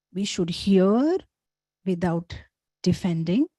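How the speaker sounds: "We should hear without defending" slowly, a pause after each word; slow stressed motto. Shown as a demonstration. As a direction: lay the sutra down word by word. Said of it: "introducing the next sutra".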